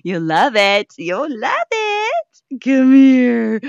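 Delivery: very high slow coaxing squeal; loud high-pitched plea to the cat. Real, not acted. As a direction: wheedle the kitty over.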